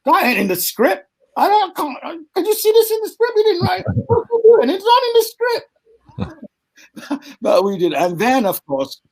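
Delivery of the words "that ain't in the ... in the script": high indignant mock rant about the script; exasperated. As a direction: squeal in outraged disbelief. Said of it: "I'm going".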